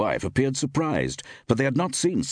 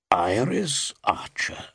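slow, higher-pitched impressed query; 5 semitones higher, slower than usual. A narrator's reading. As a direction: repeat the name slowly, clearly impressed. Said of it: "Sir Graham was obviously impressed".

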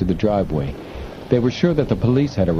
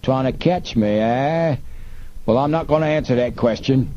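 sneering villain; 5 semitones higher than usual. A narrator's reading. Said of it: "Snapman laughed".

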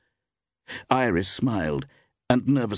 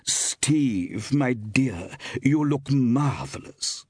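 higher, drawn-out gushing compliment; effusive cry; 7 semitones higher, much slower than usual. A narrator's reading. Actress greets her friend with both hands out, effusive. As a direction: cry out in delighted, theatrical welcome. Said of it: "cried Iris".

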